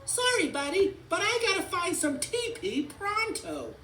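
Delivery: very high, loud urgent voice; high-pitched panicky toy; frantic race car. A narrator's reading.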